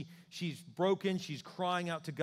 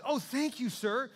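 high, grateful exclamation; a relieved woman exclaims thanks; exclaimed; 9 semitones higher, 4 dB louder than usual. A narrator's reading. Hannah thanking the priest, exclaiming higher. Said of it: "she exclaimed".

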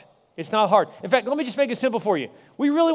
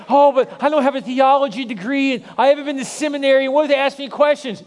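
mock worried excuse; 5 semitones higher, 4 dB louder than usual. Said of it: "some people are like".

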